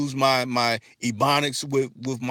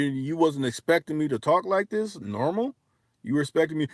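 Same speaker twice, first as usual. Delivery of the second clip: usual speaking voice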